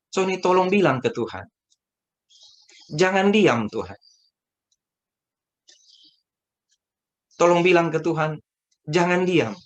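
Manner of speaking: pleading call. Retold or imitated. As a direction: beg him to pray, pressing.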